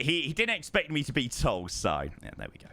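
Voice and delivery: ordinary talk, nothing marked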